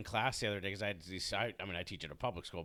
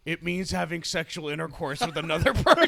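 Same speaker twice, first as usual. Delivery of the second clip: laughing through it; 6 semitones higher, 10 dB louder than usual. Retold or imitated. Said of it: "who was like".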